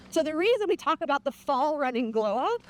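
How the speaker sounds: normal talking voice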